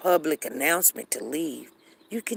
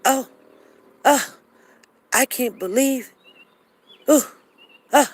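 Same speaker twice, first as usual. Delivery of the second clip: mock shocked gasp; 8 semitones higher, 7 dB louder than usual. Mocking shocked person's reaction.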